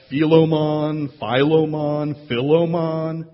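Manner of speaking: slow, loud, sing-song Philemon tries; drawn-out pronunciations. Shown as a demonstration. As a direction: sing out Philemon slowly, trying different ways. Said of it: "How do you pronounce that book?".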